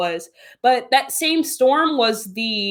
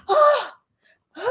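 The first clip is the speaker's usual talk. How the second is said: high, gasped ahs of fright; reenacted terrified gasps; 13 semitones higher than usual. Showing those flights that scare her, reenacted gasps. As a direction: gasp repeatedly as if jolted by fear. Acts out plane terrors on flights.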